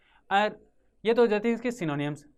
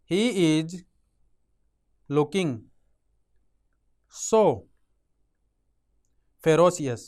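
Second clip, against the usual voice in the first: each word set apart by long pauses, ferocious said louder; word by word dictation; 4 dB louder, much slower than usual. Shown as a demonstration. Teacher dictating a sentence to students, word by word. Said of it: "अब एक sentence बना".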